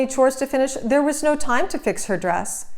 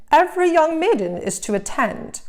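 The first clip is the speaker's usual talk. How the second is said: stepmother; 7 semitones higher than usual. Acted. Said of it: "her stepmother exclaimed".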